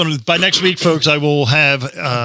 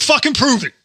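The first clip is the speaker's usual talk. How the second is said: cocky dare; 9 semitones higher than usual. Retold or imitated. Retold dare to the woman.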